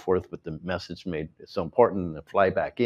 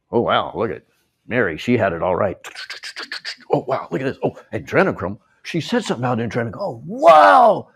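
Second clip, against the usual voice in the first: a hint of sarcastic gawking relatives; lightly mock their grudging surprise; mocking family members; 3 semitones higher, 5 dB louder than usual. Relatives in the background reacting to Mary, a light mocking gawk. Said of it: "in the background right now going".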